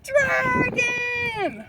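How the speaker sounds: high, slow drawn-out cry; spooky dragon call. A narrator's reading. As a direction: wail it like a ghostly warning. Brothers fake a dragon alarm.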